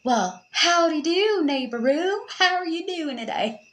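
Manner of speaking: high, hammed-up Southern accent; southern greeting. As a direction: greet like a folksy Southerner, stretching "howdy do". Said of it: "do the southern greeting".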